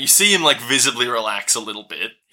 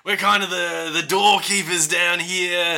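higher, friendly Aussie bloke; Aussie doorkeeper; 4 semitones higher than usual. Acted. Doorkeeper Jimmy welcomes the party, higher and cheery. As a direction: wave them in with a cheery mate.

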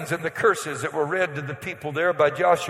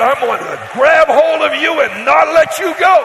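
high booming voice of God; voicing God; 8 semitones higher, 9 dB louder than usual. Preacher voicing God to a worried congregation, booming high. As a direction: raise the voice up high and loud as God promising. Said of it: "God said".

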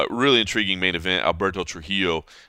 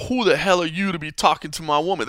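mildly menacing mock challenge, higher; imitated mad-dogging; 9 semitones higher than usual. Retelling a stranger confronting the Uber driver, mock hostile. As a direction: act out a hostile glare in words. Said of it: "mad dogging my Uber driver, like".

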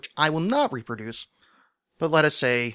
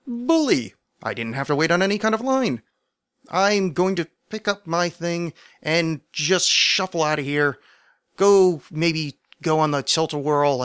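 high, louder exclamation of glee; hammed-up jolly triumph, pitched up; mock delight; 4 semitones higher, 4 dB louder than usual. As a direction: play pleased with yourself, drawing out the plans. Gleeful mock reply to screen.

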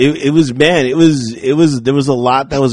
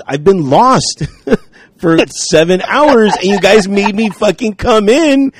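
frustrated outburst; 5 semitones higher than usual. Retold or imitated. Lost hiker telling the coworkers off, frustrated. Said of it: "I was just frustrated and everything".

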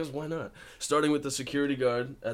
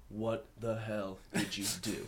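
slow, lowered-volume retold scolding; mock stern disbelief; 6 dB quieter than usual. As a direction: imitate a stunned agent, word by word, quietly. Agents scolding him on conference call.

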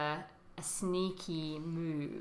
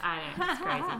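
higher, laughing agreement; 7 semitones higher than usual. Real, not acted. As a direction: giggle through it.

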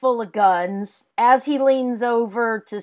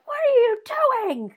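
mock alarm; 13 semitones higher, faster than usual. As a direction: retell an aghast question, pitched way up. Retold alarm at sudden theft.

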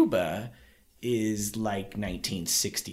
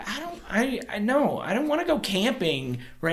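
higher, puzzled inner voice; confused; 7 semitones higher than usual. Retold or imitated. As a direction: sound baffled and a bit whiny. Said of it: "I was like".

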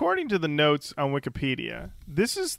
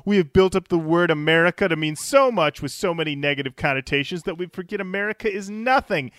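ordinary talk, nothing marked

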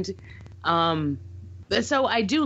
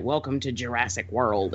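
quoting a solemn line; 7 semitones lower than usual. Retold or imitated. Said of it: "he sort of delivers that".

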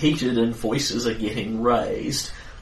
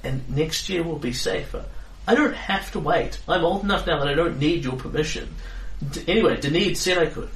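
put-on defiant teen centaur, higher; pushing back, pitched up; proud voice with horse snorts; 6 semitones higher than usual. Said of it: "she says".

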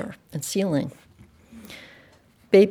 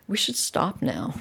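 quiet; 2 semitones higher, 7 dB quieter than usual. A narrator's reading. Child worries quietly.

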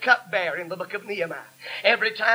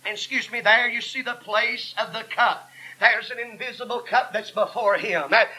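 full-force sermon with gasped breaks between phrases; fervent preaching; 3 semitones higher, 4 dB louder than usual. Real, not acted. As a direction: drive each phrase home to the congregation.

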